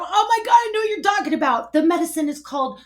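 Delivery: high, loud, gushing excitement; fast, thrilled, can't hold it in; freaking out. Retold or imitated. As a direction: blurt it out, pitch soaring. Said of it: "and I'm freaking out".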